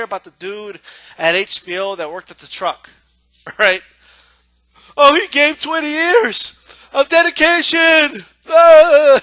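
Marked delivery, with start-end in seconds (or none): 5.0-9.2 high, loud, slow fake blubbering; hammed-up bawling, stretched out; mock crying. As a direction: imitate a tearful tribute ending in a wail. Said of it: "he started to cry about his friend".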